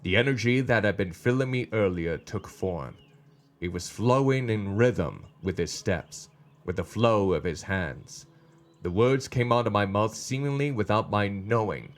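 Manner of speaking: unhurried storytelling with pauses between phrases; story narration. A narrator's reading. Reads the story aloud.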